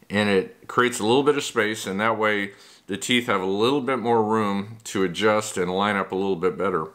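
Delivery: usual speaking voice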